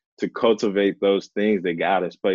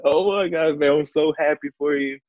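high, mock-tearful wife overcome with joy; imitated happy crying; 6 semitones higher than usual. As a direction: play a wife bursting into happy tears. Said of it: "she just bust out in tears".

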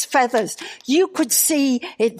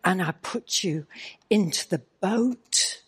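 softer, tender, reverent voice of God; Holy Spirit's gentle voice; 4 semitones lower, 5 dB quieter than usual. Retold or imitated. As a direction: speak softly like a loving divine whisper. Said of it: "the Holy Spirit said to me".

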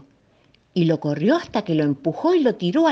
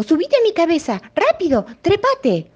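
high, loud urgent command; urgent giraffe; 13 semitones higher, 6 dB louder than usual. Acted. Giraffe urging the monkey to climb her head fast, high and loud. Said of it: "le dijo".

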